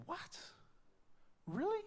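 soft "what", then high incredulous "really"; mock disbelief, rising sharply at the end; taken aback. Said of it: "I was like".

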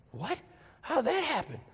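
high-pitched, incredulous retold reaction; baffled disbelief. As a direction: squeak it out in mock bewilderment. Retells his own baffled reaction.

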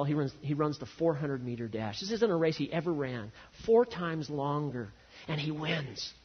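ordinary talk, nothing marked

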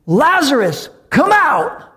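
high, loud call into the tomb; Jesus calling Lazarus out, loud; commanding call. Acted. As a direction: call him out of death.

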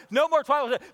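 loud, high mock outburst; comic shout. Preacher joking to the church, hammed-up high shout. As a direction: blurt it out, high and loud, playing upset.

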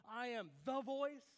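"the" punched loud before "voice"; stress on THE. Shown as a demonstration. Preacher voicing the mistaken reading, emphatic THE. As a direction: hit "the" as if the only one. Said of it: "believe that John is saying".

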